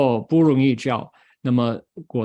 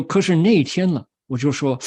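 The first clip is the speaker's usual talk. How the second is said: ordinary talk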